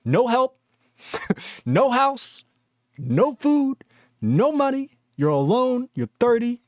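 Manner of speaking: high, singsong tally of misfortunes; mock doom list. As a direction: count off each loss high-pitched, pausing between items. Mock tally of losing everything.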